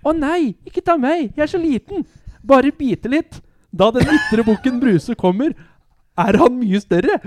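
high, pleading scared voice; frightened little goat. Acted. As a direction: beg the troll in a high frightened voice. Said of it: "Du er litt for lite skremt". (redd, bønnfallende bukk med lys stemme)

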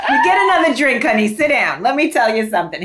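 loud, hammed-up talking-to for a date; sit them down with playful force; high, punchy playacted reveal; mock date confession.

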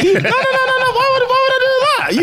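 squeaky high laughing no, no, no; laughing hard while refusing; laughing protest. Real, not acted. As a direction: laugh it off in disbelief. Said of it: "laughing high protest".

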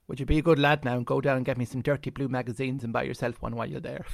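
lightly voiced old Irish rogue; cheeky old Irishman. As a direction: slip in the cheeky request like an old rascal. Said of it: "And he said to me".

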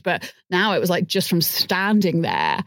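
usual speaking voice

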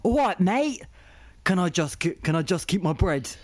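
mock British lorry driver with an English accent; matey English-accented caricature. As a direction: plead in a put-on English accent. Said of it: "Had a good English accent".

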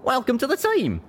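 high, eager cowboy voice; hearty welcome. Acted. Pegasus welcomes zebra to the team, eager. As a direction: welcome them in a high, lively pitch.

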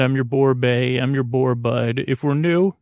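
normal talking voice

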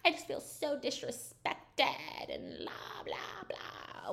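high whiny mock complaint that fades to a breathy whisper; mock whiny Angie. Angie complaining about paying for Coach Shaw's party, mocked as whiny. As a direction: mimic her self-pitying rant, trailing off. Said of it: "Angie's like".

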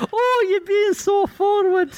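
high, slow, hammed-up Scottish accent; full-blown Scottish. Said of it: "he's full-blown Scottish".

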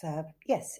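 ordinary delivery

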